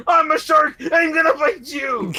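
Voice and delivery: high, loud laughing yell; mock shark scream. Man leaping from water at swimmers, loud mock scream. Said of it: "starts screaming".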